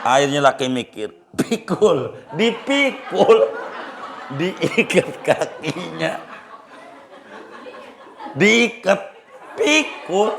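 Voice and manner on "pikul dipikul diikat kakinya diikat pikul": husband thinking aloud with a laugh. Retold or imitated. Husband decides to carry the cow.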